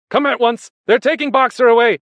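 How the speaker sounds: high, very loud cry of alarm; alarmed shout. A narrator's reading. Benjamin warning the animals about Boxer, frantic shouting. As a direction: yell the warning across a farm. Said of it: "he shouted".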